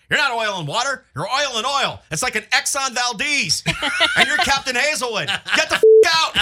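dad's loud, high-pitched tirade, building to a yell; ranting dad impression. Dad scolding over a bad relationship, building mock yell. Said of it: "My dad'd be like".

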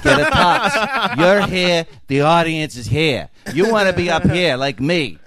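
imitating a bossy veteran comic; emphatic lecture. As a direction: lay down the law to a rookie.

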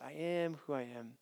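"I am who I am" said softly, falling away. Shown as a demonstration. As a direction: murmur "I am who I am" low and quiet. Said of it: "two different ways you can understand that".

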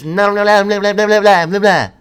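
high, loud nonsense babble; mimicked yelling woman. Imitating a woman yelling gibberish behind him. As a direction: screech the blahs like a street crazy. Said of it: "And a woman yelled".

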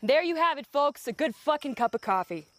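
ordinary talk

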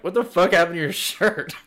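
hammed-up alarmed disbelief; mock bewildered family. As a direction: demand it in acted shock. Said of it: "And they're like".

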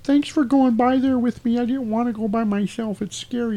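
high-pitched girl imitation; mock little girl. Girlfriend thanking Marty by the murder house, high girl voice. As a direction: play a timid child, hammed up.

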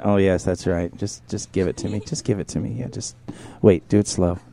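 soft, low coaxing murmur; hushed coaxing. Real, not acted. Host coaxing a guest to undress, hushed. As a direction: lure her along.

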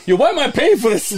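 high, loud, laughing yell; mock outrage shout. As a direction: fake indignation, shouted. Mock indignant quote, shouting.

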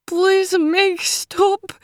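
high, drawn-out, tearful plea; imploring whimper. A narrator's reading. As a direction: beg like a frightened little girl. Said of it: "The imploring tone in her high-pitched whimper".

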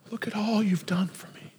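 hushed, reverent gratitude; awed whisper. Retold or imitated. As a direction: whisper it in wonder, as the grateful woman. Acting the grateful woman's awe.

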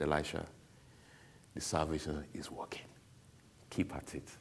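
low, soft, unhurried mentor; calm reassurance. Retold or imitated. Mentor reassures him on a call.

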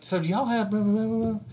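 a hint of a fussy Southern lady; mock customer chatter. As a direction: mimic her endless questions, trailing into blah. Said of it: "and I hear, like".

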